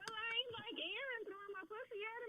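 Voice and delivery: high-pitched flustered protest; voice shooting up high. Real, not acted. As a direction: protest with your voice jumping way up high. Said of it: "Why your voice go up so high".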